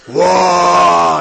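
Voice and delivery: loud sustained whoosh of a heater; loud imitated heater blast; heater roar. Imitating roaring space heater.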